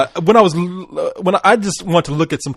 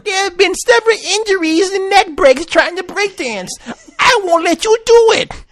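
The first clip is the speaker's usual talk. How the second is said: mock commercial voice; 13 semitones higher than usual.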